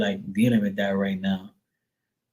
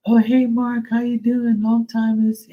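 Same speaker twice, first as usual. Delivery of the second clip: much higher, fake-surprised sing-song hello; imitating the daughter; 11 semitones higher than usual. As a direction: pretend she just happened to bump into him. Mimicking the daughter again.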